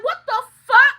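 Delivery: high, loud, outraged yell; furious shout. Retold or imitated. Walking in on the mother-in-law, shouting high and loud. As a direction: blast it like you just walked into a fish fry. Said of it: "I said".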